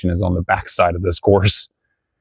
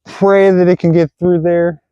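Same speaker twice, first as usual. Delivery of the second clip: laughing; 10 semitones higher than usual. Real, not acted. Hopeful shot through trees.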